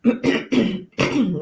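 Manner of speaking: a quick, real throat clear. Real, not acted. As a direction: clear your throat mid-talk. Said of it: "when I yawn or clear my throat".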